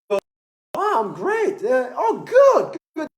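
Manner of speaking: high, upbeat acted reply; happy voice. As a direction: pitch leaping up with exaggerated cheer. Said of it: "That's a happy voice".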